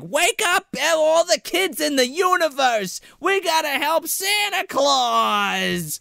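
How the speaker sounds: high, slowing shouted call; hammed-up kid rousing the town; the kid calls all children to help Santa; mock child's rally cry. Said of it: "he's like".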